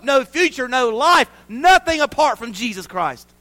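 loud, high, hammered delivery; full-force preaching. Real, not acted. Minister declaring his job to the church, full-force preaching. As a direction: build to a forceful proclamation.

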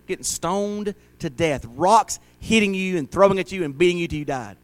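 ordinary talk, nothing marked

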